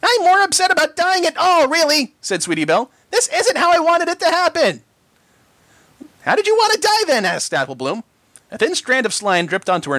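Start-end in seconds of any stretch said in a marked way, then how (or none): 0.0-4.8 frightened. A narrator's reading. Terrified filly facing death. 6.3-7.2 frightened. A narrator's reading. Frightened filly.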